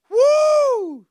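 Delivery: falsetto whoop of shock; high excited whoop. Retold or imitated. Preacher recalling meeting his soap star crush, high whoop. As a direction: shriek with starstruck delight.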